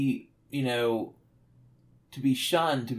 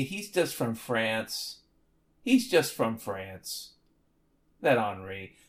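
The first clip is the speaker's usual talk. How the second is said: slow, lightly put-on European accent, trailing softer; mock European accent; 3 semitones lower, 4 dB quieter, slower than usual. Excusing the robot Henri, mock European accent. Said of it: "a very strong European accent".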